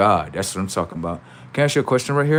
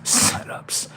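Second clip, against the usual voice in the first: whispering. Real, not acted. Whispered aside after laugh.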